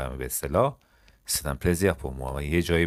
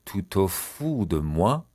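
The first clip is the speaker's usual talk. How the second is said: "Tu te fous de moi" stretched, rising at the end; slow demonstration; much slower than usual. Shown as a demonstration. Teacher repeating a French question slowly for learners. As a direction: draw out "fous", lift on "moi".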